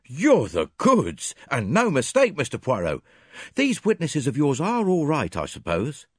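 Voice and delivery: a hint of a hearty Scotland Yard inspector, high and loud; bluff, impressed policeman; lightly voiced inspector praising Poirot; Japp, lightly voiced. A narrator's reading.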